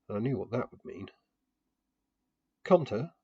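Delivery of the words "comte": put-on louder, higher call up to the boy. A narrator's reading. Narrator calls Comte down from the statue, loud and higher. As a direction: call his name up the statue. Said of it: "I called".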